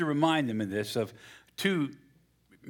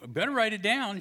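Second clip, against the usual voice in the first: higher, wheedling wife voice; wife's voice; 9 semitones higher than usual. Acted. Pastor voices the wife asking for strawberries. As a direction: put on the nagging wife.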